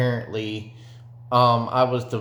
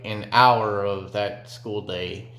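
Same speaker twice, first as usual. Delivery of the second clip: ordinary delivery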